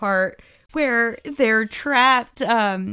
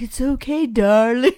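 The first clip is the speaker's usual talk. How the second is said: imitated sweet-talk; 3 semitones higher, 4 dB louder than usual. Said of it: "love the way he's like".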